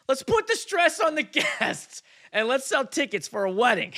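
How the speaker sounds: high, loud mocking read with laughter; incredulous mock quote. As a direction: ridicule the idea, laughing through it. Reads bride's reasoning, laughing in disbelief.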